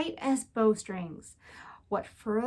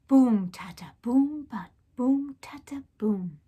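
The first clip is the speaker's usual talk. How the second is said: whispered drumbeat. A narrator's reading. Narrator ends the drum call, whispered chant. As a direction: drum sounds whispered, fading off. Drum echoes fading away.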